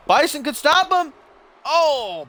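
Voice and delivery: high, loud burst of match commentary; excited live call. Real, not acted. Commentator calling a last-second save, excited shout. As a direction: build to a high, urgent cry.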